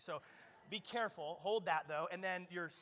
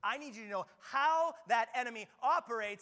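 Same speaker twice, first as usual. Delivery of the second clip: an urgent charge that grows louder; building sermon; 5 semitones higher, 6 dB louder than usual. Acted. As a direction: rise to a rallying cry. Paul urging the Ephesians.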